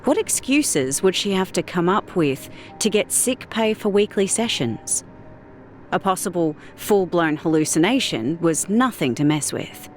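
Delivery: plain reading voice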